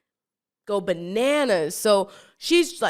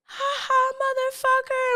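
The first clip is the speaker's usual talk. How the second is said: much higher, mocking cackle of the crazed girl; mocking taunt. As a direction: taunt them like a crazed villain. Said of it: "she's like".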